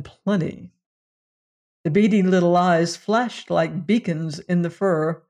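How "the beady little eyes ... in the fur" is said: usual speaking voice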